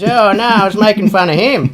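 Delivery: high, loud mock ocker barman; punchline in a hammed-up Aussie voice; bartender voice. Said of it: "the bartender goes".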